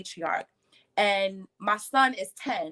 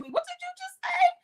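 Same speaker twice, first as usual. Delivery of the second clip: shocked disbelief. Retold or imitated. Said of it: "I was like".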